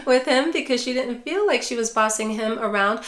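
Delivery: ordinary talk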